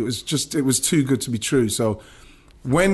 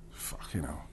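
barely-voiced whisper of disbelief; shocked whisper; 7 semitones lower, 17 dB quieter than usual. Real, not acted. Remembering his friend being sold, a stunned whisper. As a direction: breathe it out, gutted.

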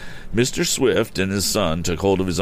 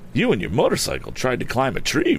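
wry youth, pitched up; grim humor; 8 semitones higher than usual. A narrator's reading. Boy explains the crash to the rider, with grim humor. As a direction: deliver a mildly teasing explanation. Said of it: "remarked Tom with grim humor".

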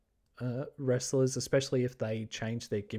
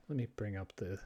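ordinary talk, nothing marked

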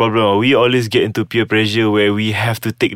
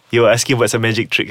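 ordinary talk

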